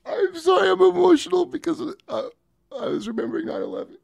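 high-pitched, choked-up apology; crying. Pretend. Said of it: "Curb's crying".

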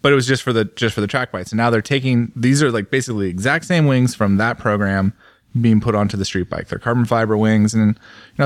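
usual speaking voice

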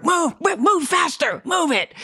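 very high, pushy scream; screamed orders. Retold or imitated. Bill Murray driving him to set, high screamed orders. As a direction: shriek it right into his ear. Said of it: "screamed in my ear".

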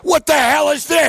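mock outraged yell. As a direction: blurt it like a man stunned by the total. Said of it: "He's looking at the bill".

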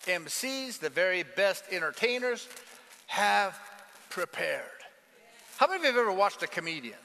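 usual speaking voice

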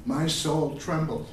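slow, measured poetry recital; solemn tribute poem read aloud. Acted. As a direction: let each image land slowly. Reads his poem to Vallejo.

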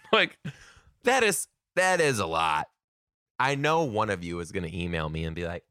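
ordinary talk, nothing marked

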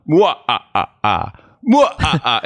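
measured cartoon vampire laugh; Dracula judging laugh. Dracula judging a joke's laughs, deliberate mock-evil laugh. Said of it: "what if Dracula was a comedy writer".

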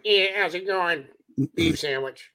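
lightly put-on Northern accent; mock their Northern speech; gently mocking Northern accent. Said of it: "I make fun of their accent".

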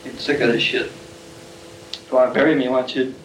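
plain reading voice

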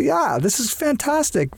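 high, drawn-out exclamation of delight; retold delight. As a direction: relive the happy find, pitched high and slow. Retelling delight at finding local beer.

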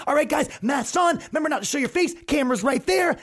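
high hammed-up organizer calling out; mock protester voice. Comic imitation of a protester giving orders. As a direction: ham up a protester rallying the group.

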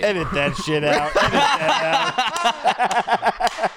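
laughing through the words; helpless laughing plea. Real, not acted. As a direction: crack up while asking for a cut. Laughing after own crude joke.

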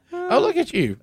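high teasing laugh through words. Real, not acted. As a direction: tease him while laughing hard.

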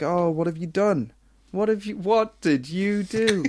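light, gently surprised parent with a small laugh; mock mild parental surprise. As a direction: play it as a harmless prank reveal. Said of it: "But instead, it was like".

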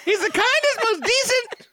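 very high cartoon voice; squeaky vintage cartoon voice. Joining the cartoon bit.

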